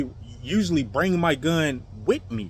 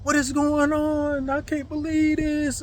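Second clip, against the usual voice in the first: imitated screaming; 10 semitones higher, slower than usual. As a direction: mimic her wailing protest, high and drawn out. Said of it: "she's over there screaming like".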